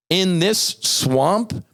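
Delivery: slow, high sneering swamp voice; mock congressman. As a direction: drag out the words like a smug insider. Imitating congressman's phrase.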